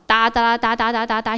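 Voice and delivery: rapid monotone "da-da-da" typing noise; imitated typing. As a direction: rattle it off like keys clacking. (模仿飞快打字的哒哒声)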